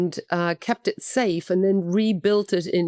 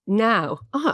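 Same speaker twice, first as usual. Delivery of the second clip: ordinary talk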